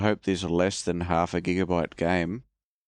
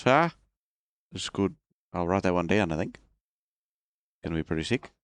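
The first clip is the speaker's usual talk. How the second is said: bad Australian accent. Announcing bad accent time, then playing an Aussie bloke. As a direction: lay on a cheesy Australian accent. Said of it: "Bad accent time".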